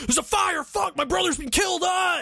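high-pitched mock radio screams; panicked screaming. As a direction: act out soldiers shrieking in panic. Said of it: "you hear them on the ground screaming".

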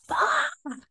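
a crying shout of frustration; reenacted yell. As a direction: let the word fly out as a tearful yell. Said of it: "really loud".

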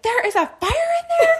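put-on very high, small child's question; young boy asking the babysitter about the fire; little boy. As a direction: pipe up like a frightened little boy. Little boy asks, voiced by teller.

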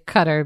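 "Qatar" as CUTTER; "Qatar" with first-syllable stress, CUT-er; current pronunciation. Shown as a demonstration. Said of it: "so now I say".

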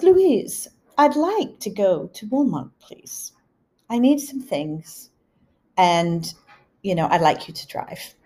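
imitating an elderly English mother, lifted opening; English accent. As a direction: put on Mum's English accent, politely requesting. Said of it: "if I switch into an English accent, I'm imitating my mother".

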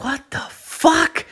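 very high, slow, drawn-out disbelief; high stunned what the fuck. Retold or imitated. Telling his girlfriend, stunned and squeaky high. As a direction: squeak it out in shock. Startled by ladder story.

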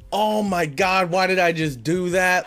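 high, loud self-reproach, retold; exasperated outburst at yourself; pissed off. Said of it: "I was so pissed off".

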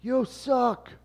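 high, loud mock yell at a rival fan; retold heckle yell. Mets fan yelling at a Yankees fan, high loud mock yell. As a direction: bark it across the stands like a fan. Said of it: "just yells at him".

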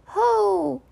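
high, restrained little HO; small creature's subdued high call; rather quiet. A narrator's reading. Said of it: "in a rather quiet voice".

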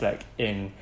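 ordinary delivery

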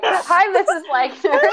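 high, slow, sheepish hello; awkward polite greeting. Retold or imitated. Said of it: "being like".

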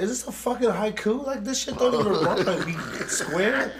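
laughing mock insult. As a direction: sneer at the poem through laughter. Mocking the poetry reader, laughing.

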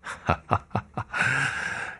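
soft, breathy sneering chuckle; cold laugh. Acted. Ghost general laughing coldly at the painter's question. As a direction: let out a chilling little laugh. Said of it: "将军冷笑着说".

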